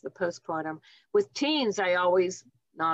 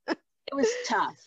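choked up; 5 semitones higher, slower than usual. Real, not acted. Recalls a hard birth.

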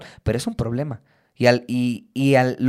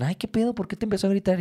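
puzzled friends; 6 semitones higher, 4 dB quieter, faster than usual. Retold or imitated. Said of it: "me dicen".

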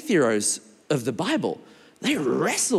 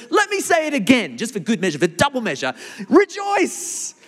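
high-pitched preacher's proclamation, peaking on rejoice; emphatic proclamation; 8 semitones higher than usual. Acted. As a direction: proclaim it, leaping up high on the last word. Preacher acting out the scripture's command.

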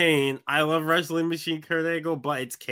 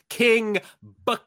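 lightly hammed-up name reveal; playful bit of ring-announcer flourish; mock announcer; 5 semitones higher than usual. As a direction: draw out the name with a pause before the last word. Picks name in mock announcer style.

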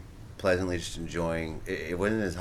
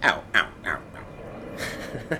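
distorted pained voice; 3 semitones higher, much slower than usual. Shown as a demonstration. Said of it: "Manipulate your voice".

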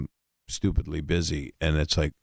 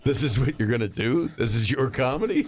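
higher, put-on Robin Williams voice, mock disbelief; Robin Williams impression; 7 semitones higher than usual. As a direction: mimic Robin questioning the young comic. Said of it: "but Robin said".

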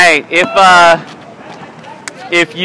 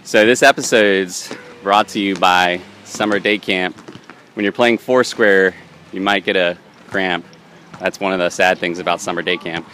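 ad read; 9 semitones lower, 4 dB quieter than usual. Acted. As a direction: read it like a radio ad. Mock sponsor read.

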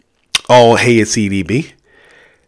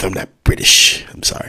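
ordinary delivery

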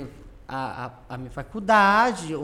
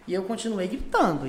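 ordinary delivery

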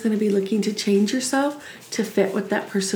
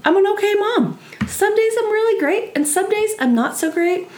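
much higher, lifted and cheerful; high, light self-assurance; bright upbeat; 9 semitones higher than usual. Real, not acted. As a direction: sing it out like good news. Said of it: "my personal favorite".

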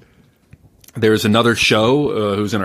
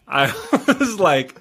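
laughing; 5 semitones higher, slower than usual. Real, not acted. Laughing while recalling anger.

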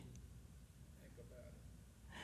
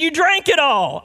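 high-pitched imitation of an indignant wife; hammed-up shouted blame; mock outraged accusation; 10 semitones higher, 5 dB louder than usual. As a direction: act out a loud, comic accusation.

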